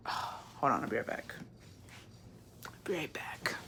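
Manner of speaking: hushed, barely voiced; whispered aside. Real, not acted. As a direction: whisper an apology as you step away. Stepping away, whispering.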